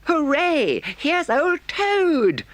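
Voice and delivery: high, loud joyful Mole voice; a beaming mole cheering a friend; Mole crying out. A narrator's reading. Mole greets returned Toad, joyful cry. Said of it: "cried the Mole".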